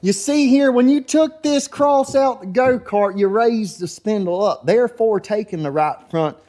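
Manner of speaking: high, slow drawn-out mechanic voice; imitating Thug Life. Thug Life explains the kart to the racer, high mock voice. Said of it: "He says".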